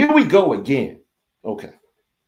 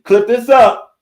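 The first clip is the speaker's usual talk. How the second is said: urgent call out; 9 semitones higher, 6 dB louder, slower than usual. Real, not acted. Host urging viewers to record.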